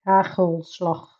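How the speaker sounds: Hagelslag slow, both G's rasped in the throat; Dutch G. Shown as a demonstration. Streamer showing chat the throaty Dutch G, slowly. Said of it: "we also have the G".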